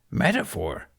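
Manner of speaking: put-on a wise old creature, gently scolding; Yoda voice. Yoda lecturing Ben on his tea, amused.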